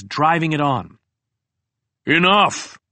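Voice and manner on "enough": booming voice of God, somewhat restrained; commanding scream. A narrator's reading. Someone halts the attacking crows, booming godlike command. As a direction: bellow it once like an almighty command. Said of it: "screamed someone with the voice of God".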